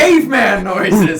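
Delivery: loud, slow shout; excited shout. Real, not acted. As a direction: call it out big.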